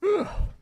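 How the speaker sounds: a long, real tired sigh, pitched high. Real, not acted. Streamer massaging his arm, sighing tiredly. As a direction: let out the tiredness in one breath. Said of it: "So tired".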